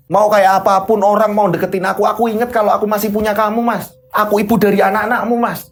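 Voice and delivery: hurt protest. Acted. Wife hurt by husband's insult.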